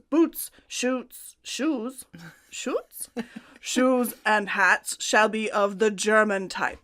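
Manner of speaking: put-on Russian-accented decree, stumbling over a word. Co-host stumbles through the emperor's clothing list, Russian accent. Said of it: "That's my Russian accent".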